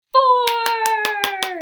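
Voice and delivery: one loud, high, long-held shout; excited cheer. Real, not acted. Teacher cheers the answer to children.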